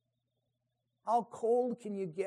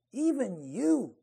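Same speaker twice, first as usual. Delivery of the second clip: Even you said slowly, you leaned on; slow down and press the word you; stress on you. Shown as a demonstration. God reproaching Edom, slow and stressed on you. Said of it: "emphasis is placed on the word you".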